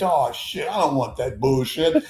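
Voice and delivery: lightly voiced Oklahoma accent; Mickey Mantle. Mantle waving away Billy's devotion, mild Oklahoma accent. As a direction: brush off the praise with a hint of Oklahoma drawl. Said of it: "Oklahoma accent".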